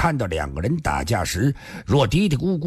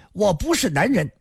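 higher-pitched protest, only mildly forceful; raised voice; 7 semitones higher than usual. A narrator's reading. Sun Xiaohong objects to her rival's argument, mildly raising her voice. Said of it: "孙小红大声地说".

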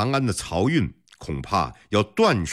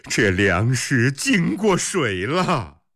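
put-on old man; 5 semitones higher, slower than usual. A narrator's reading. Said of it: "一个老者抓过一把米说".

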